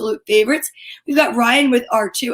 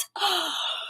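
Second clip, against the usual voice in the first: big gasp; 6 semitones higher, 10 dB quieter than usual. Acted. Dramatic reveal for kids.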